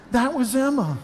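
usual speaking voice

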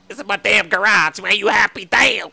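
loud, resentful surrender; bitter shout. Acted. Homeowner shouting at the gunman as he gives up his garage, loud and bitter. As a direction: yell it as you hand over the cash.

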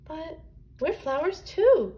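high-pitched plea from the weeds; protesting cry. A narrator's reading. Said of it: "cried the weeds".

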